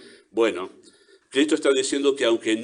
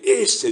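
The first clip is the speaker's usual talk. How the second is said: "ese" pushed out louder; stress on ese; 2 semitones higher, 4 dB louder than usual. Shown as a demonstration. Preacher showing the congregation the accent, stress on "ese". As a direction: lean hard on "ese", pointing someone out. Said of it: "noten ese acento ahí".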